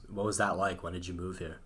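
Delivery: usual speaking voice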